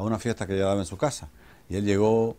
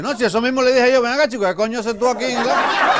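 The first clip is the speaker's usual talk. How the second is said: high, loud mock indignation; indignant comeback; 12 semitones higher, 6 dB louder than usual. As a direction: protest loudly at a high pitch. Hunter's outraged comeback to doubters. (réplica indignada, aguda y fuerte)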